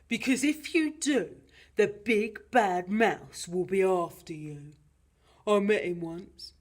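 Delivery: loud, warning monster dad; Gruffalo father. Acted. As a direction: warn your child in a big voice. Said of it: "said the Gruffalo".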